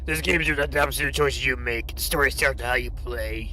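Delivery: put-on game narrator announcement. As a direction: do an exaggerated narrator voice, straining the throat. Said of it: "the narrator voice that I try to do".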